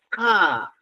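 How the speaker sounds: a hard K from far back in the throat. Shown as a demonstration. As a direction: postvelar K. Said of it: "y dices".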